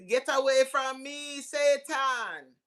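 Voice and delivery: high, drawn-out theatrical rebuff; playful high-pitched rejection; mock rebuke. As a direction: banish them like a hammed-up exorcist. Acted rebuff of gossiping coworker.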